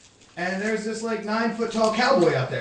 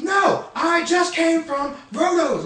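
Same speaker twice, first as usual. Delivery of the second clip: slow, shouted, insistent correction; the angry cowboy insisting on Rotos; furious cowboy; 7 semitones higher, 3 dB louder, much slower than usual. Retold or imitated. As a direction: drag it out angrily, word by word. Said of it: "This guy's getting furious".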